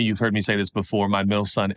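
ordinary talk

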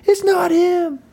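very high, strained scream; re-enacted screaming. Witness screaming to clear the little guy, re-enacted scream. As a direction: scream it as if across a street. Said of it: "hear me screaming at the top of my lungs".

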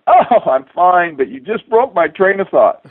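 faking patience at a bad moment; mock annoyed. Astronaut answering the commander mid-effort, mock annoyed and slow. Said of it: "And I'm going".

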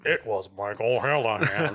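low, slow robotic voice. Pretend. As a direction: say it like a flat robot. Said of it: "robotic voice style and shit".

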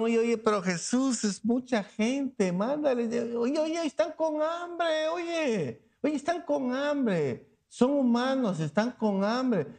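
a hint of worried disciples begging Jesus; pleading apostles. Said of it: "Los apóstoles le dijeron".

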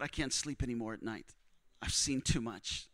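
low, hushed retelling of despair; broken. Retold or imitated. Preacher voicing a broken man at night, subdued. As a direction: quote him softly and low, worn down. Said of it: "with this broken look in his eyes".

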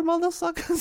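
jumps way up high for the insult; squeaky mock insult. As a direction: tease her in a squeaky high voice. Teasing playful insult.

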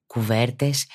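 ordinary talk, nothing marked

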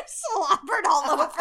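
loud laughing line. Real, not acted. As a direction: say it while cracking up. Laughing at spit.

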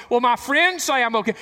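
high, loud mock self-satisfied voice; mock the self-assured sinner; smug excuse. Preacher mocking a churchgoer's excuse, high and smug. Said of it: "mock excuse".

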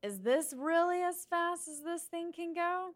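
high, slow in-character voice; Chuck. Acted. Rider goading new friends to speed the boat, high slow character voice. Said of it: "Chuck's gonna turn to her n-, new friends and say".

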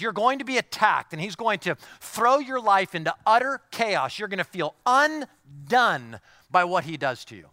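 rising sermon fervor; full-force preaching. Acted. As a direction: preach it out to the congregation.

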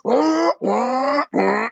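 high, rising motor wail; imitated engine revs. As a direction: mimic a car climbing through gears. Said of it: "just shifting gears".

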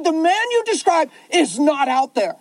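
put-on upset girl. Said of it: "She freaked out".

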